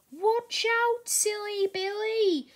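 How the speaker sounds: a squeaky engine shouting in alarm; peeped warning. A narrator's reading. Percy, covered in coal, cries out at Billy, high and loud. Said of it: "Percy peeped".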